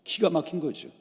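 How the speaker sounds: ordinary talk, nothing marked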